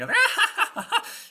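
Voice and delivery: high, loud communal cackle; witches' laughter. Acted. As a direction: cackle along with the coven. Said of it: "they all start laughing".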